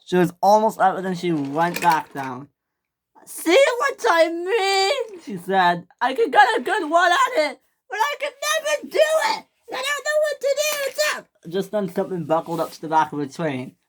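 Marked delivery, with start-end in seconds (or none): 3.3-5.2 high, drawn-out whine; frustrated wail. A narrator's reading. Millie slipping back down the hill, frustrated wail. 6.0-11.3 high-pitched frantic whining; despairing wail. A narrator's reading. Millie unable to climb the hill, despairing high wail.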